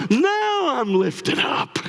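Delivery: high, slow, hammed-up elation; sarcastic, drawn-out joy; mock uplifted. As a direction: stretch it out in fake delight. Mock feeling after worldly talk.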